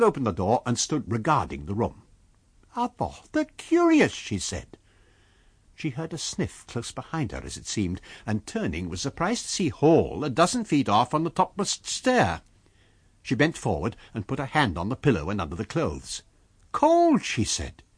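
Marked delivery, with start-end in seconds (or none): 2.7-4.1 high, startled landlady's cry. Acted. Mrs Hall exclaims. 16.7-17.2 high, sharp landlady exclamation. Acted. Mrs Hall feels the bed.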